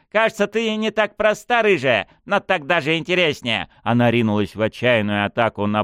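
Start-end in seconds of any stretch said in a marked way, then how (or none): 0.1-3.7 self-assured. A narrator's reading. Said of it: "ровно и самоуверенно".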